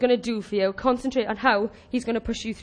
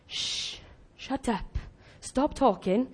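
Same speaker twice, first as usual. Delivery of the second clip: soft, measured shushing; hushing command; 10 dB quieter, slower than usual. Acted. Preacher voicing Joshua silencing the marchers, hushed command. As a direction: shush them, then order silence under your voice.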